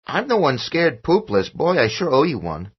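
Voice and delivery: put-on a kid joking about his fright; child's voice. A narrator's reading. Son joking with father, high child voice.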